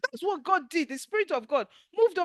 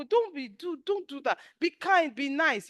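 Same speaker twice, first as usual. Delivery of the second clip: ordinary talk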